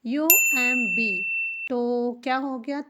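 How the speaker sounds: ordinary talk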